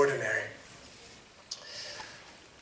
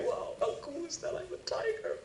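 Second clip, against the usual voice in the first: much higher and much softer, a playful tiger; 12 semitones higher, 11 dB quieter than usual. Pretend. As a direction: squeak it out as the proud little tiger.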